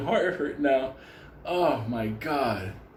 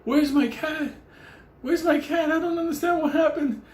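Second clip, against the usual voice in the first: high, distraught pleading; frantic cat owner. Retold or imitated. Cat owner begging Benny for news, high frantic voice. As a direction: cry out for the lost cat. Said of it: "the lady's waiting by his door".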